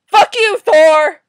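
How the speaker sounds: high, very loud curse; sudden full-volume outburst; furious yell. Real, not acted. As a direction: explode at the character. Cursing another comic character.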